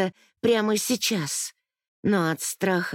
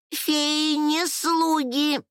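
high, drawn-out fairy cry; high-pitched protest from a little fairy; indignant cry; 8 semitones higher, much slower than usual. A narrator's reading. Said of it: "снова закричала Лала".

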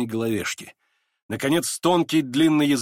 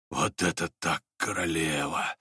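low, soft, savoured words; awed Рогожин; 7 semitones lower, 5 dB quieter than usual. A narrator's reading. Рогожин, in raptures, repeats it to anyone near, low and awed. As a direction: murmur it low, drawing out the last word. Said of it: "повторял он поминутно".

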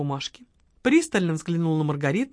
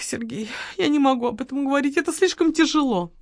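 staged sorrow in a raised voice; theatrical widow; 5 semitones higher, 4 dB louder than usual. Acted. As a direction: perform the grief for the detective. Margarita reciting her testimony.

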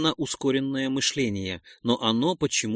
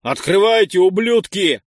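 much louder, higher furious yell; shouting; 6 semitones higher, 9 dB louder than usual. Acted. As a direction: bellow at the unseen captors. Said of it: "Крикнул".

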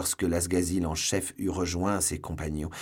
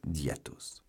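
usual speaking voice

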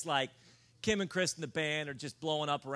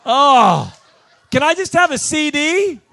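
much higher, much louder hammed-up disgusted whine, with a laugh; mock her disgust, dripping with contempt; disgusted plea; 9 semitones higher, 18 dB louder, slower than usual. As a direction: fan sighing in disgust at the preacher, exaggerated whine. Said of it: "and disgust".

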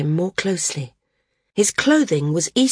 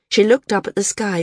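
usual speaking voice

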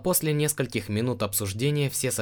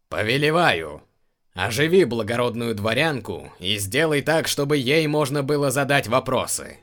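commanding; 3 semitones higher, 6 dB louder than usual. Acted. Emperor issues formal command.

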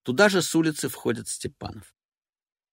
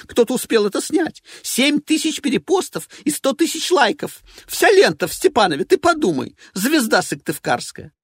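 high, loud and scornful; a diva ranting with envy; shrill jealous rant; 11 semitones higher, 6 dB louder than usual. Acted. Evelina ranting.

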